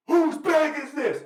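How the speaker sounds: slow, loud, high bark of an airport guard; yelling guard. Retold or imitated. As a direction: shout it across the security line, slowly. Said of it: "They keep yelling".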